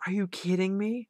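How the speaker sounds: high, stunned cry of disbelief; shocked disbelief. Retold or imitated. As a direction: voice the owner's shocked outburst. Owner finding out car stolen.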